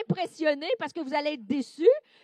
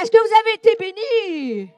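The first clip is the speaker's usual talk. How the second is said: excited call; 7 semitones higher, 12 dB louder than usual. Real, not acted. Asks the women, emotional.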